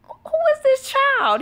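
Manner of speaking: high, drawn-out mock disbelief; incredulous. Mom reacts to her bold baby, incredulous. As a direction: squeal it slowly in comic disbelief.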